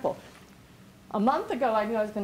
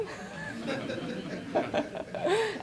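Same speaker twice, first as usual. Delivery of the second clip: high unvoiced laugh; breathless laugh; 11 semitones higher, 4 dB quieter than usual. Real, not acted. Laughing at Rick's last-minute prep, breathy laugh. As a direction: burst into a long airy laugh. Laughing after incredulous quote.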